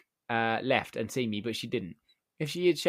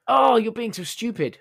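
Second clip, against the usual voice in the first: slow, far higher, louder yell of contempt; furious yell; 11 semitones higher, 10 dB louder than usual. Retold or imitated. Customer screaming at the man she thinks is staff, slow furious yell. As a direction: scream it down at him, exasperated. Customer yelling at non-employee.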